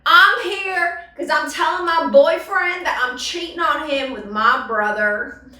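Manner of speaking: hammed-up talk show confession; trailer park guest. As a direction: announce it like a trashy Jerry Springer guest. Parody of Jerry Springer guest.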